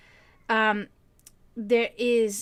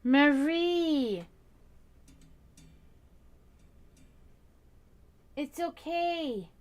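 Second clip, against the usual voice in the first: slow tender coo; 5 semitones higher, much slower than usual. Real, not acted. Owner calming her upset cat, slow high coo.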